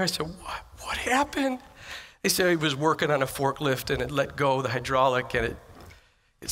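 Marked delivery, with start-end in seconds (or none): 0.3-1.6 shocked question. Retold or imitated. Hearing of a coworker's accident.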